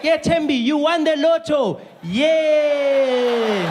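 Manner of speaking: high, slow mock cheer with a frozen face; deadpan fake cheer. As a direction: cheer without moving the face. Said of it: "you'd be like".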